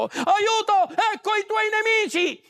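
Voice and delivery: high, urgent mock alarm call; fake cry for help. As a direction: pretend to raise the alarm, pitched high. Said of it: "finse che arrivassero i filistei".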